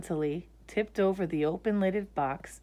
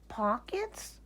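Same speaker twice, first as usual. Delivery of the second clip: to himself; 6 semitones higher, 8 dB quieter than usual. Acted. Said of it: "said Corduroy to himself".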